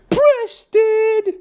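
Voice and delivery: imitating a kid yelling for the butler; squeaky kid voice. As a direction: call out like a squeaky little kid. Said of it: "In his super squeaky voice".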